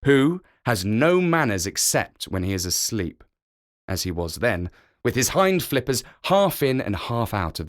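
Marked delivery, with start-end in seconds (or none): none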